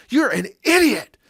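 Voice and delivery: sharp, loud, high mock shout; angry insult. Preacher acting out an angry man insulting someone, shouting. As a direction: snap it in someone's face. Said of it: "you're so angry with them".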